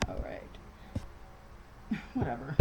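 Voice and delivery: breathy whisper to herself; whispered aside. Real, not acted. Vlogger fussing over her lighting, whispered aside. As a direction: whisper it under your breath, giving up. Fumbling with bad dining room lighting.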